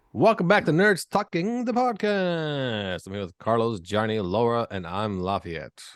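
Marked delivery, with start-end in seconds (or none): 0.1-3.1 high, drawn-out show-opener; announcer welcome. Real, not acted. Host welcoming listeners back to the show, high drawn-out announcer call. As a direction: sing out the title, stretching it slowly.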